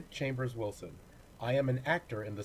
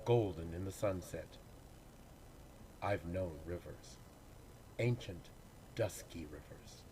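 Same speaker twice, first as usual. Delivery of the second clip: low, solemn recital fading much softer at the close; poetry reading; 3 semitones lower, 4 dB quieter than usual. Acted. Actor reciting Hughes to the congregation, solemn and fading. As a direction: carry it like an old river, letting it sink away.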